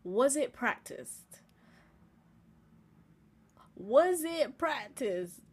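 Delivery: slow, drawn-out "Was it practiced?"; slow dramatic question. Acted. Teasing her viewers with a slow dramatic pause. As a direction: let each word hang for drama. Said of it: "let's be real".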